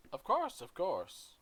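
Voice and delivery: a gracious dwarf queen, warm welcome. Acted. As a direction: greet the guests broadly. GM voices the queen.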